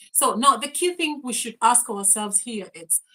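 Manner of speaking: ordinary delivery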